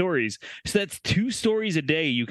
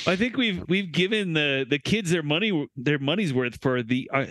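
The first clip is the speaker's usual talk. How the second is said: halting false starts and repeated words; stumbling. Real, not acted. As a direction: stutter your way through the sentence. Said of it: "stutter through this".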